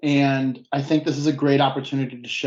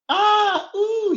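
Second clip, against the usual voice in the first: high startled cry; startled yelp; 4 dB louder than usual. Acted. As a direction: jolt at the sudden danger. Sudden tire in acted scene.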